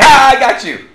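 loud, high mock gotcha; triumphant shout. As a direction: crow over winning the game. Acted win in a thanks competition.

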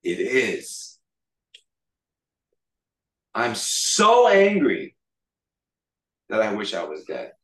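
acted angry defiance, pausing between phrases. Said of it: "he said an offensive Hebrew phrase".

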